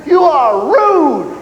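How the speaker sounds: slow, loud, laughing yell; yelled back. Retold or imitated. Recruit yelling back at the sergeant, slow laughing shout. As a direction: drag out the retort as a laughing shout. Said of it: "wanted to yell back at him".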